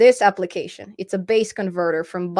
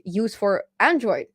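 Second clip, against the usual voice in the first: ordinary talk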